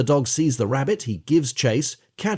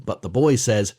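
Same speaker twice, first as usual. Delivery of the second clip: normal talking voice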